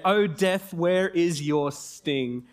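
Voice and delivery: drawn out, taunting death; slow mocking quote. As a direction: stretch "where is your sting" very slowly. Mocking death, quoting scripture.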